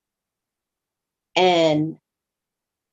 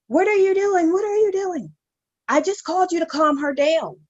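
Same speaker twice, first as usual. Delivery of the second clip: much higher, frantic mother's cry; mom screaming; 11 semitones higher than usual. Retold or imitated. Mom screams at police taking her daughter, frantic and high. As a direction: shriek it like a panicked mom. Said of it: "my mom was screaming".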